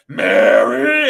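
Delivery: half-hearted imitation of a grumpy old man yelling "Mary!"; mock scream. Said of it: "start screaming Mary".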